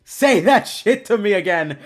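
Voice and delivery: loud, high, stretched-out words; indignant scolding. Real, not acted. Streamer scolding over a wild remark, loud drawn-out outrage. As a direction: hammer it out slowly at full volume.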